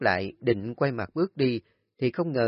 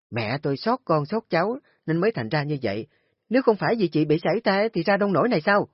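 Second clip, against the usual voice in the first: furious retort; 6 semitones higher, 4 dB louder, faster than usual. Acted. Nga fires back at Kim.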